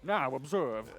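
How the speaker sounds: put-on old man voice, slow and deliberate. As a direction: speak like a wise old master. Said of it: "his old man voice going".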